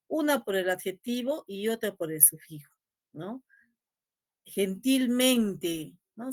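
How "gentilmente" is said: "Gentilmente" very slow, accenting both "gentil" and "mente"; drawn out with two beats, gen-TIL-MEN-te; two stresses. Shown as a demonstration. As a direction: pronounce "Gentilmente" slowly with both accents heard. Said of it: "Si yo lo pronuncio".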